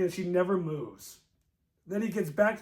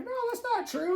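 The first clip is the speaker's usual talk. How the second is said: mock friend voice; faster than usual. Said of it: "And he's like".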